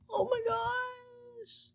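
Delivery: high, slow, strained squeal of disbelief; almost crying with joy. Real, not acted. Gamer squealing at the rare pet she finally got.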